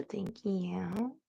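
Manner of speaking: soft breathy whisper. Real, not acted. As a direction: whisper the thanks under your breath. Whispered thanks to chat viewer.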